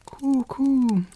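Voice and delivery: high, cooing pigeon call. A narrator's reading. Wood pigeon calls to Gerda, high cooing. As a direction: coo it like a bird.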